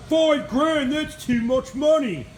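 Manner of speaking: high, loud mock British bloke, the accent deliberately overdone; bad British accent. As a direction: imitate English blokes complaining about the price in a hammed-up British accent. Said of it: "My accent sucks".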